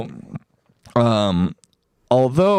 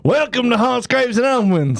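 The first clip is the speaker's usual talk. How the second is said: announcer intro; 11 semitones higher than usual. Acted.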